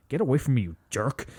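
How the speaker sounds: mock outburst with the Scottish accent slipping; high start, dropping away, Scottish accent lost; slipped Scottish accent. Imitating the elf whose accent slips, Scottish accent lost. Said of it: "the most inconsistent Scottish accent".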